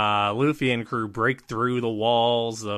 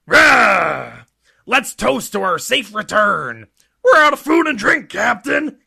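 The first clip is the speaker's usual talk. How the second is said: high, loud rowdy giant shouts; loud giant voices; 10 semitones higher, 9 dB louder than usual. Acted. Giants acted cheering.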